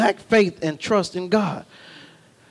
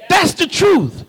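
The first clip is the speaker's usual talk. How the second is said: higher, ringing proclamation; preaching shout; 7 semitones higher than usual. Acted. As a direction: call it out to the church.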